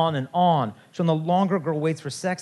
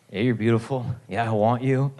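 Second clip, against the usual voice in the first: put-on smooth older guy; 6 semitones lower than usual. Older guy sweet-talking girl.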